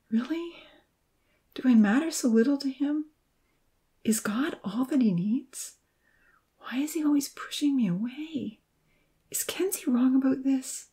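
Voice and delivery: soft whispered doubts to herself; inner thoughts whispered. A narrator's reading. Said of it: "she pondered".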